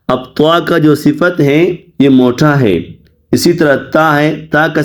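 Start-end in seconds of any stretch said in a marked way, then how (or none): none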